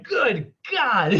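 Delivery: hammed-up gasp of shock at a bent elbow; imitated shock. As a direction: play a shocked onlooker for a laugh. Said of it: "you're like".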